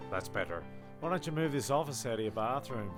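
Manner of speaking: normal talking voice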